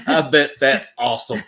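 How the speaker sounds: high, loud laughing remark. Real, not acted. Tickled by a friend's accent, laughing. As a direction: burst into laughter as you say it.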